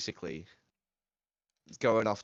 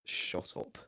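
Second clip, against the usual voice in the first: whispered; 6 dB quieter than usual. Retold or imitated. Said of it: "And I was like".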